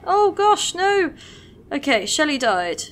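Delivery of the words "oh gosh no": high, loud cry of dismay; a sudden yelp of dismay as the unit falls; pitched-up, louder "oh gosh, no"; real dismay. Real, not acted. Unit about to die.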